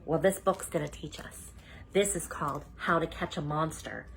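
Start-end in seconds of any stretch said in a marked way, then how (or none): none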